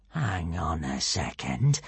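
low, slow, soft villain voice; low, drawn-out doubt; suspicious villain. A narrator's reading. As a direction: say it slowly as realisation dawns. Alvin's voice as he gets suspicious.

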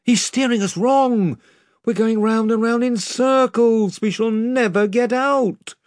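high, frightened complaint; panicky accusation. A narrator's reading. The rowers accuse Drinian of steering wrong, high and panicky.

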